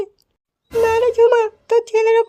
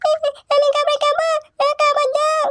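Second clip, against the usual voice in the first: put-on whiny child voice; 4 semitones higher than usual. Child begging for food.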